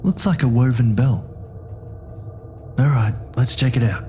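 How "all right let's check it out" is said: normal talking voice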